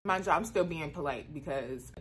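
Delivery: ordinary delivery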